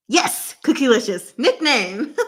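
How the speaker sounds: usual speaking voice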